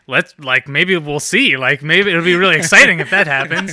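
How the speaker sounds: eager, rising excitement; getting hyped about the crash; excited. Retold or imitated. Excited retelling of own hopes.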